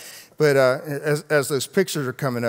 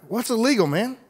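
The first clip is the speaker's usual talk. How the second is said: much higher, baffled what's illegal; puzzled question; 11 semitones higher, 3 dB louder than usual. Retold or imitated. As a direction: sound totally confused. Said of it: "And I'm like".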